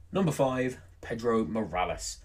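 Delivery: top ten countdown. Acted. Countdown entry announcement.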